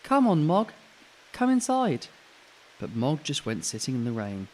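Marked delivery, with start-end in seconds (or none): 0.0-2.1 calling the cat. Acted. Child calling cat indoors.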